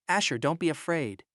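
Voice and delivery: usual speaking voice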